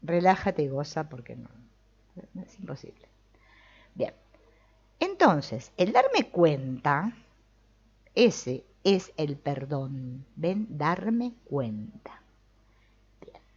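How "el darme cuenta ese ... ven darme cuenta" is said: "darme cuenta" drawn out slowly; slow emphasis. Shown as a demonstration. Teacher stressing the key point, slow and deliberate. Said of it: "emphasizes key phrase".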